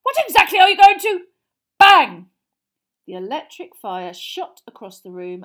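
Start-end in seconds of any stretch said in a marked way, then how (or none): 0.0-1.3 high, loud, angry command; bellow. Acted. Uncle shouting at the fire, angry bellow. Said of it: "he bellowed at the fire". 1.8-2.3 very loud, sudden, higher burst; explosive "bang". A narrator's reading. Fireplace bursting outwards, explosive shout.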